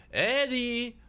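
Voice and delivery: loud high-pitched holler; high, insistent yell at someone behind a door; calling through a door. Acted. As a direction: call out to be let in from outside. Voice shouting from outside door.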